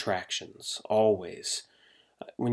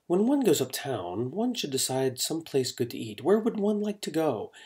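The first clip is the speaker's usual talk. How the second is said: higher-pitched, put-on airs with swooping tune; mock fancy scholar; 4 semitones higher than usual. Teacher mocking students hanging out with friends, mock fancy. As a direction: parody a pretentious scholar chatting with friends. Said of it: "like you're trying to be this fancy, educated".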